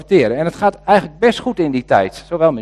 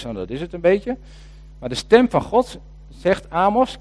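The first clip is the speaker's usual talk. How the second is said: usual speaking voice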